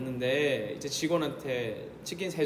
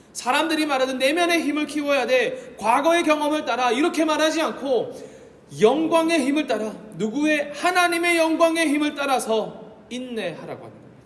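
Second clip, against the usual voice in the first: higher, louder sermon climax; full-force preaching; 8 semitones higher, 7 dB louder than usual. Acted. Pastor pressing the congregation, higher and louder. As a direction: drive the point home from the pulpit.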